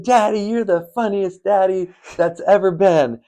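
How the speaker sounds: put-on high, raspy five-year-old girl voice; raspy little girl. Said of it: "she has this raspy voice".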